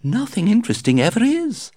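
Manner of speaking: high, chirpy, breezy society lady; gushing society hostess. A narrator's reading. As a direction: flutter through it like a gossiping hostess. Lady Windermere speaks.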